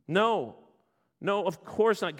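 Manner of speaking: ordinary talk, nothing marked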